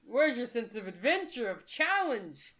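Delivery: high, loud hammed-up encouragement; rally them with exaggerated gusto; mock pep talk. Host rallies the group to see six players as a challenge, mock pep talk.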